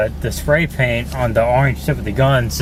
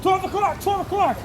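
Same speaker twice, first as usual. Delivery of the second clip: high, urgent called warning; 5 dB quieter than usual. Acted. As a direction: call out the direction, urgent. Shouting orders in film shoot.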